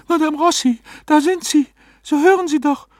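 very high, loud, agitated woman's voice; urgent pleading. A narrator's reading. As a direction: implore the frozen medium, high and insistent. Client urges medium to respond.

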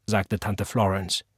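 ordinary delivery

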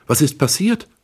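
put-on high, panting young woman's voice; gasping girl. A narrator's reading. Carmen waking up in the car, gasping and high. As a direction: gasp it out, dazed and breathless. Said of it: "keuchte sie".

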